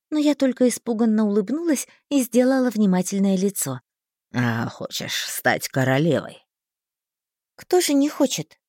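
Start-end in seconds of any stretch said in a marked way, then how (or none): 4.3-6.4 low, slow tempting schemer; sly man voice. A narrator's reading. Thin tempts her. 7.6-8.6 put-on high, reasonable girl; sensible tone. A narrator's reading. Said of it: "рассудительно сказала я".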